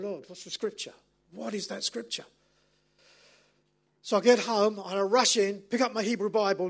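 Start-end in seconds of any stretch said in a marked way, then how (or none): 0.0-2.2 soft worried plea to God; quiet anxious prayer. Retold or imitated. Driving home, praying softly in worry about the wrong verse. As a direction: murmur a panicked prayer under the breath. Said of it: "And I'm going".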